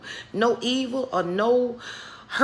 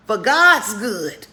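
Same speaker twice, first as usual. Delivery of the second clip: louder, very slow, stretched out; slow emphasis; 9 dB louder, much slower than usual. Acted. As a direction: lean hard on every word. Emphatic correction in sermon.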